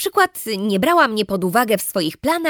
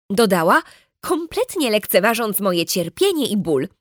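plain reading voice

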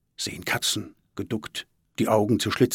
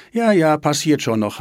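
higher, louder brush-off; impatient; 6 semitones higher, 7 dB louder than usual. A narrator's reading. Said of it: "hat Svenja ungeduldig gesagt". (ungeduldig abwimmelnd)

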